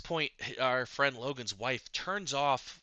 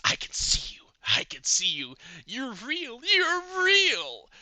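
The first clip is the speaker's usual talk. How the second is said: whispered then rising to a high shriek; insane NPC; 11 semitones higher, 5 dB louder than usual. Acted. DM voicing a madman in a straitjacket, rising to a shriek. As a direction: build from a hiss to a high unhinged cry.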